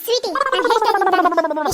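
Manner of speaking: loud, fast scolding; angry mother shout. Acted. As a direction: cut her off sharply. Mother snaps at pestering daughter. (ಕೋಪದ ಅಮ್ಮನ ಕೂಗು)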